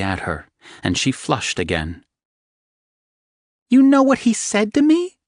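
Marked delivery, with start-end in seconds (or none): 3.7-5.2 much higher and louder squeak; high embarrassed squeak. Acted. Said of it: "she squeaked".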